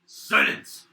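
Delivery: a sudden loud barking command; shouted silence. Acted. Guard shouting at his comrade for silence. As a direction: shout them down to hush them. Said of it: "He goes".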